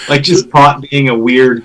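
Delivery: normal talking voice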